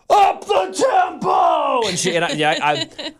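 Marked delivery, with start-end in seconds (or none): 0.1-1.9 high, slow, booming radio voice; projected yell. Retold or imitated. Re-enacting his yell to the soccer team, projected shout. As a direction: project it across the field, every word stretched. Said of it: "I really quickly stood up and I yelled".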